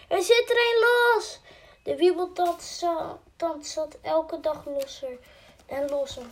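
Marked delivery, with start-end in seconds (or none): 0.1-1.4 high, loud cry of news; alarmed shout. A narrator's reading. The kitchen maid shouts that the tooth is loose, high and loud. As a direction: shout it through the palace. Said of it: "schreeuwde de ko-, keukenmeid".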